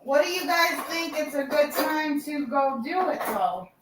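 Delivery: usual speaking voice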